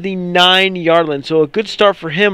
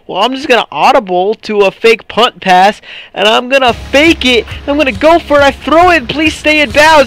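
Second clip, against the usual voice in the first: rising higher and higher, more and more excited; hyped inner plan; 6 semitones higher than usual. Retold or imitated. Gamer voicing his fake punt plan, rising excitement. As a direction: talk yourself into the trick play, getting giddy. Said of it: "So I'm like".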